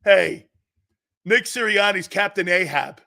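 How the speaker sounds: ordinary talk, nothing marked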